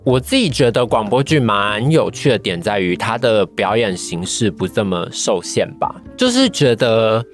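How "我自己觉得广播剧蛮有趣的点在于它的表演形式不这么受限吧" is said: normal talking voice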